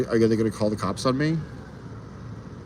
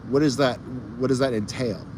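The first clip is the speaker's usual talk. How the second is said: usual speaking voice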